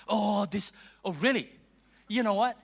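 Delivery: usual speaking voice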